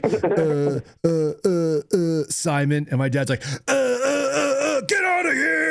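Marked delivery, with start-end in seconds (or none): 0.0-2.8 put-on a lightly raised, hesitant kid stammering out an excuse; nervous stammer. Stoner kid caught by his friend's dad, nervously stammering. 3.7-5.7 much higher, hammed-up retold yell; mock scream. Dad with a shovel screaming at his son's stoner friend, hammed-up yell. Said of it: "he, like, just screams".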